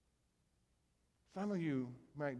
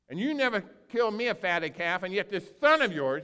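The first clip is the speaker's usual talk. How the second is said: indignant; 5 semitones higher, 9 dB louder than usual. Retold or imitated. Older son complains to father.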